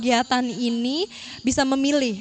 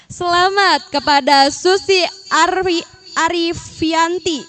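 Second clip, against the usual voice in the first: higher, louder winner announcement; booming prize announcer; 4 semitones higher, 5 dB louder, slower than usual. Acted. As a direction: announce the winner like a game show host. (pengumuman pemenang yang lantang)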